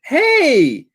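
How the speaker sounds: high, loud excited call; excited hey. Acted. Emil calling out happily to Sonny, high and loud. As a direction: shout a happy greeting. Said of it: "He's like".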